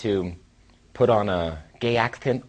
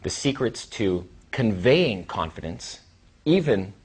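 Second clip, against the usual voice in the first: ordinary delivery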